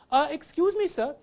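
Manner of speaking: put-on high, loud comic Indian accent. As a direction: exaggerate a very grammatical Indian English accent. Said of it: "if you're like an Indian".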